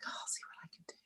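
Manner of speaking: imitating a friend's noncommittal whisper; mock hesitant whisper. Retelling her friend John's reluctant answer, in a mock whisper. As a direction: hush it like a reluctant promise.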